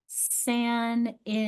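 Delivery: Sanity very slowly, syllable by syllable; short A demo. Shown as a demonstration. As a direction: break Sanity into san-i-ty with short A. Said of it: "we have a short A".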